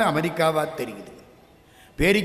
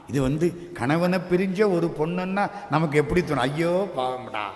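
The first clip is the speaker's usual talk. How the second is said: usual speaking voice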